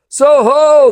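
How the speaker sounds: high, loud bellow into the dark; roaring call. Acted. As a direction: roar it out as long as you can. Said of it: "sang out as long as he could roar".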